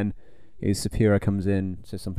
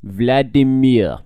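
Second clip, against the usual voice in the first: put-on louder, slow Russian accent; 5 dB louder, slower than usual. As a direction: announce it in a hammy Russian accent. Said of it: "In a Russian accent".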